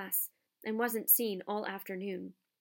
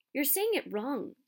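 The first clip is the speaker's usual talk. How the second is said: snapping; 5 semitones higher, 3 dB louder than usual. A narrator's reading. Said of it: "Hermione snap".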